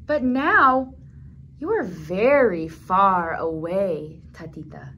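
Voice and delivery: slow story line fading to a hush on Tatita; storybook reading, grief gently; tender sad reading. Acted. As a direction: let the sadness settle, trail off.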